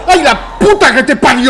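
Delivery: high, loud scream into laughter; scream, then crack up laughing; startled scream. Real, not acted. Sudden scare in game.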